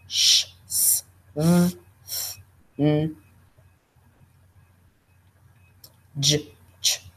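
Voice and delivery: sounds said in isolation; isolated consonant sounds; speech sound demo. Shown as a demonstration. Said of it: "you start to see frication take place".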